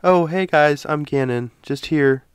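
fake normal voice. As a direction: play the polite fake host. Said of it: "I have to act like".

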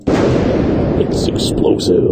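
whispery, very slow trailer voice; slow, voiceless announcer growl; movie voiceover. Pretend. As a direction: stretch it out in a sinister whisper. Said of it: "Rindle can do the full voice".

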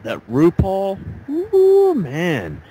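a swooping high "Ooh" of a lovestruck guy; smitten admiration. Retold or imitated. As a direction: swoon over the star on TV. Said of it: "this one guy goes".

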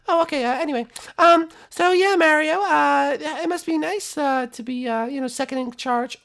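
high falsetto small talk; chatty princess voice. Acted. Princess making small talk on a date, high voice.

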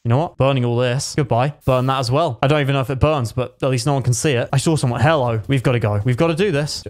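usual speaking voice

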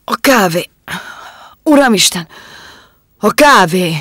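loud, gasping cry of horror; a poisoned man crying out in panic; choking outcry. Acted. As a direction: shout it out as the poison hits.

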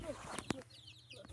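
hushed whisper, no voice; soft breathy pointing out; whispered look. Real, not acted. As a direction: whisper so you don't scare them. Quietly pointing at animals.

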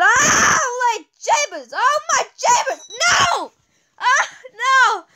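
high-pitched wails of disbelief; distraught outcry. Real, not acted. Gamer losing just short of the goal, distraught high outcry. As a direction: cry out in dismay, pitch shooting up. Losing right after hoping for record.

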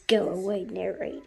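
whispered go away. Real, not acted. A child tells the narrator to go away in a whisper. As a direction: hiss it under your breath.

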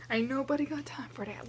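breathy whispered meme line; whispered meme quote. Retold or imitated. Quoting viral meme line.

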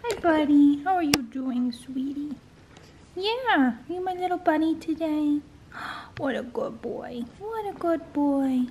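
high, tender coo to a dog; doting pet talk. Real, not acted. Owner fusses over her dog while working, dotingly. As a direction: greet and praise a beloved pet in a lifted sing-song.

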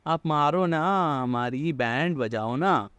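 drawn-out, slow mock plea; mock begging. Gamer voicing enemies who insist on being beaten, mock begging. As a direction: stretch the words like someone begging for a beating. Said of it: "but ये बोलेंगे".

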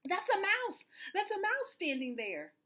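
high-pitched storybook panic; panicked shout. A narrator's reading. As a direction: plead for your life in comic alarm. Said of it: "shouted the elephant, jumping in the air".

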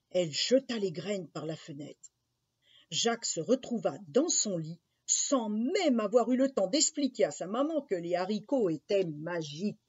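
plain reading voice

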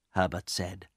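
ordinary delivery, nothing marked

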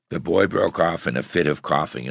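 plain reading voice

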